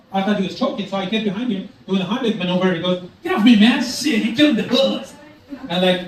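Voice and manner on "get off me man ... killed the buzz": high-pitched, put-on annoyed stoner; stoned friend protesting. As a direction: protest in a high voice after a puff. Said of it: "One takes a puff and goes".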